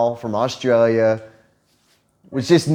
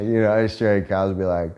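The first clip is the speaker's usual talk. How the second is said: normal talking voice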